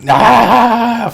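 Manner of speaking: high, loud drawn-out "aah"; mock battle yell. Gunner firing wildly, mock crazed yell. Mimics gunner yelling as he fires.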